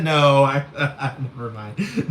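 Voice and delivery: usual speaking voice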